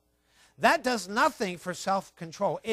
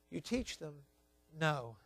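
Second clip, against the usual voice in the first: quiet echo; 5 semitones lower, 11 dB quieter, slower than usual. Acted. Quiet repeat of the point.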